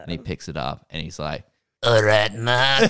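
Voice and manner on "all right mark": loud, put-on British accent; hammed-up British accent, second attempt; Pierce Brosnan. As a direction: belt out a mock British greeting. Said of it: "I'll try again, I guess".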